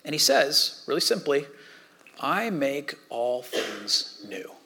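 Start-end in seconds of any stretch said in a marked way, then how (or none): 2.2-4.5 very slow and soft, each word weighed; slow, solemn proclamation. Acted. God from the throne, slow and soft.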